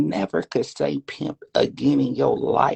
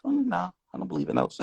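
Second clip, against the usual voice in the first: normal talking voice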